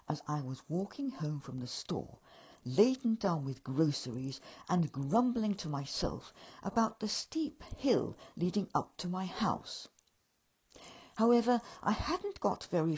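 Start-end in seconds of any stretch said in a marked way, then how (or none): none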